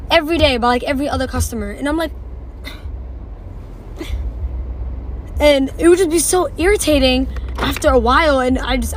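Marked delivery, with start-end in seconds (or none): 2.2-5.3 long weary sigh; exasperated sigh. Retold or imitated. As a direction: heave a long fed-up sigh, no voice. Irritated by repeated question.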